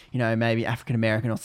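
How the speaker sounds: ordinary talk, nothing marked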